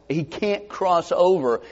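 ordinary delivery

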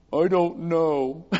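slow, drawn-out mock-kid answer; a hint of a clueless child, stretched out; lightly voiced sheepish child. Voices child asked what they're sorry for.

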